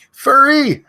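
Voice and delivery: high, loud shout of the name; screaming a name. Real, not acted. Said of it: "these people that I'm yelling".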